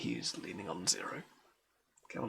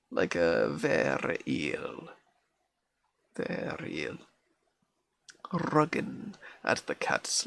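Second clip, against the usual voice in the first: nursery rhyme recited in broad Scots; Scots accent; 2 semitones higher, 6 dB louder, much slower than usual. Acted. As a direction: put on a Scottish accent, calling out the lively lines. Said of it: "this is gonna be an accent".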